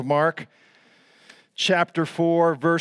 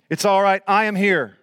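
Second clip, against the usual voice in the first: loud, higher-pitched assurance; bold proclamation; 5 semitones higher, 5 dB louder than usual. Acted. God to believers, loud confident proclamation. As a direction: declare the comfort with force. Preacher proclaims God's reassurance.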